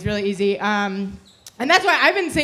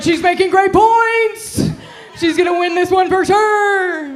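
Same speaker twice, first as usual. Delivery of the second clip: high, loud, drawn-out cheering; mock spectator shout; 9 semitones higher, 6 dB louder, slower than usual. Comic cheers on the arguing girlfriend, loud hollered commentary.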